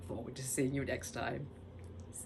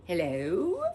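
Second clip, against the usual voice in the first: ordinary delivery, nothing marked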